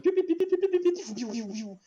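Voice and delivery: high loud pew pew blaster sounds, fading at the end. Acted. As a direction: fire off high blaster noises. Narrator voices blaster fire.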